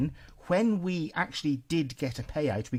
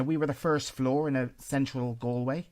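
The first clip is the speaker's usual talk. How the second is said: ordinary delivery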